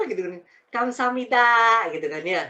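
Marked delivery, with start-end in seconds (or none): 0.7-1.8 playful Korean imitation. Playfully mimics Korean thanks.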